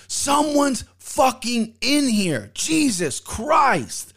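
high, slow mock yell through the door; retold yell. Man stuck in a stall yells at someone yanking the door, high slow mock yell. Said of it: "That's what I yelled".

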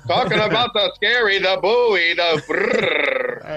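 high, slow radio host voice. Pretend. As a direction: play a hammed-up live radio announcer. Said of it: "I'll come in with my radio voice".

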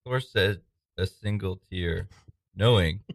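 ordinary talk, nothing marked

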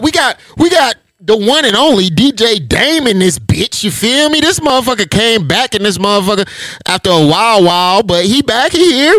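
high, excited podcast host shout-out; big hype-man welcome; hype host intro. Acted. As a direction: hype up the guest like an announcer. Host hypes guest intro.

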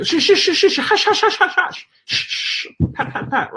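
high, fussy shushing, dropping to a voiceless hush at the end; mock hushing woman. Owner mimicking the dog woman's useless hushing, high then whispered. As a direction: imitate a flustered woman hushing her dogs.

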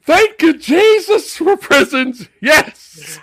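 high, loud, laughing thanks; laughing mock praise. Pryor thanking Jesus for prisons, loud laughing praise. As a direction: shout thanks to heaven while laughing.

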